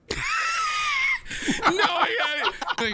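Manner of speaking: squeaky falsetto, then slow and stretched; high strained awkward voice. Retold or imitated. He voices John putting up with being called Tony, in a high slow falsetto. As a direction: voice his awkward acceptance, high and drawn out.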